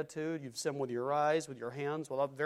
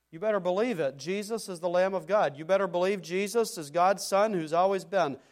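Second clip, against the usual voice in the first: raised, louder pulpit exhortation; rousing preaching; 4 semitones higher, 6 dB louder than usual. Real, not acted. Preacher urging listeners to believe in Jesus, rousing preaching. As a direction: press the congregation urgently to believe.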